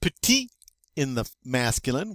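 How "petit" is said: PETIT with no T at the end; silent final T. Shown as a demonstration. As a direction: end "petit" on the open ee vowel. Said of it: "We don't say that final T".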